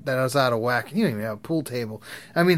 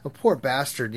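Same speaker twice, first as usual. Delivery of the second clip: normal talking voice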